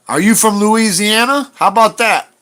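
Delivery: high mocking sing-song; mocking question. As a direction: parrot the viewer's question in disbelief.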